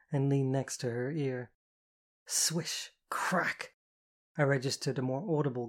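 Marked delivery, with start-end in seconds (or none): 2.2-3.7 a hissed swish and a sharp crack; cane swish and crack. A narrator's reading. Narrator voices the cane strike, swish then crack. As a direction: make the cane's sound with your mouth.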